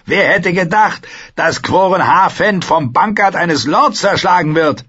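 high, loud, jeering wildling voice; amused wildling. Acted. Wildling marvels that a bastard beat the ranger, amused. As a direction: crow over the surprise, high and loud.

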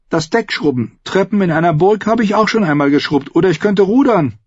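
put-on high girl's voice, pushing harder; eager girl. Arya begging the captain to take her on, high and eager.

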